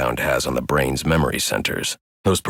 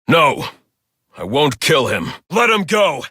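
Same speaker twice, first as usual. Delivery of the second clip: loud, high, forceful refusal; desperate shout; 13 semitones higher, 5 dB louder than usual. Acted. Vigilante shouting at a captor to let a hostage go, loud and high. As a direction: shout him down, no killing. Refuses to kill, demands release.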